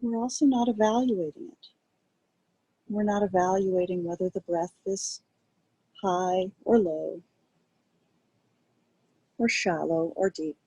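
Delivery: calm, quiet guiding voice with long pauses; guided meditation. Acted. Teacher guiding a group to rest attention on breath, calm meditation voice. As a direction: ease listeners gently into noticing the breath, unhurried.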